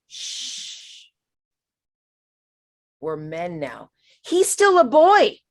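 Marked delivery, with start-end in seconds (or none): 0.1-3.9 hushing dad. Retold or imitated.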